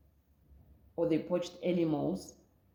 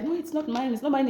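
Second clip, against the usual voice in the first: quick, higher it's not mine; high panicked denial; 6 semitones higher than usual. Retold or imitated. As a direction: push it away nervously, pitched up. Guy denying bag at scanner.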